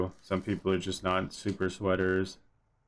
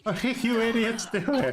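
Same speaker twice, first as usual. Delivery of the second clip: high-pitched, laughing through the words; real laughter breaking into the question; amused disbelief; 14 semitones higher than usual. Real, not acted. Streamer laughing at the players' antics, amused disbelief.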